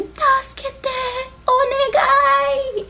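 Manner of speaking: high, drawn-out wail for help; desperate plea. Retold or imitated. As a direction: imitate a pleading cry, stretched out slowly. Imitating friend's cry for help.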